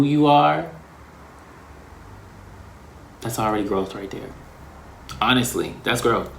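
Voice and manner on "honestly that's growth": ordinary talk, nothing marked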